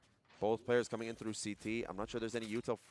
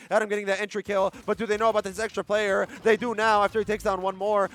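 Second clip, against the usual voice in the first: loud, high play-by-play; esports caster; 10 semitones higher, 13 dB louder than usual. Real, not acted. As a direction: shout the entry kills. Caster hypes entry kills.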